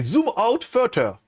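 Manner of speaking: slow, careful English command in a heavy French accent. Shown as a demonstration. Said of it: "mon accent est un petit peu à chier".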